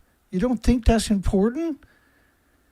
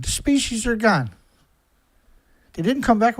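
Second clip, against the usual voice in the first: ordinary delivery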